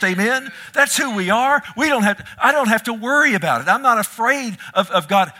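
high, loud rousing sermon climax; climactic preaching. Real, not acted. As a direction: lift the church to a shouting peak. Climactic shouted preaching with laughter.

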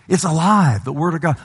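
normal talking voice

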